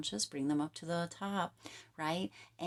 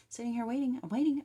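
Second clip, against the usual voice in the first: lilting higher mocking voice of someone waiting; mock impatient querent; 8 semitones higher, 4 dB louder, faster than usual. Reader mimics Aquarius sitting waiting for change, mocking lilt. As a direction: play the restless client who only wants change.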